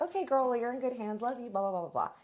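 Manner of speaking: high, breezy friend's goodbye, lightly voiced; a hint of a chirpy friend rushing off; Shane's quick goodbye. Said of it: "Shane's like".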